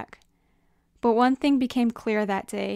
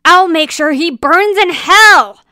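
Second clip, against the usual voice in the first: slow, high, vengeful shout; loud villain's threat, full of rage; furious yell; 8 semitones higher, 15 dB louder than usual. Acted. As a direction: yell it as a drawn-out curse. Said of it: "yelled a villain".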